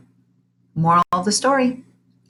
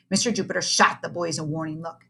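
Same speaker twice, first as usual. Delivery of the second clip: plain reading voice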